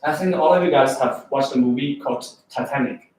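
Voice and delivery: ordinary delivery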